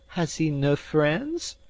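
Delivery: high, slow tearful question. A narrator's reading. The maid pitying the sick lad, slow and tearful. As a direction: near tears with pity. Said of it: "said she in a tearful voice".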